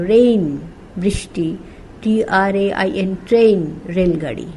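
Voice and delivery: ordinary talk, nothing marked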